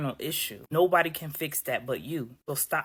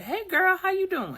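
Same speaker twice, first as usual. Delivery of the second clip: put-on high, bright-eyed hey girl greeting, much higher; bubbly greeting; 4 dB louder than usual. Greeting a friend you run into, high and bubbly. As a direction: chirp it out to an old friend. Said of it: "you like".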